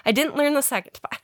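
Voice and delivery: ordinary talk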